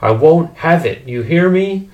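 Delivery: high, loud, indignant refusal; crying out. A narrator's reading. Mother laying down the law to father, crying out. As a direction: insist fiercely, pitch climbing to the question. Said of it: "Mother cried".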